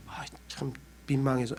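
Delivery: very soft, awkward murmur; almost too embarrassed to say it; embarrassed hush. Retold or imitated. Church member asked where he went, embarrassed hushed reply. Embarrassed answer about absent believer.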